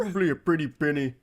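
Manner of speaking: lightly imitating a stuffed-up nerd; quick, mildly congested mimicry; mock congested voice. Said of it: "He sounds so fucking congested".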